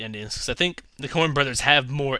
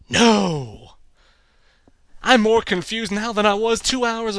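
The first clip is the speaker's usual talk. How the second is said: higher, louder incredulous rant; exasperated confusion; 6 semitones higher, 4 dB louder than usual. Pretend. Confused viewer ranting at the painter, higher and louder. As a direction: sputter like nothing adds up.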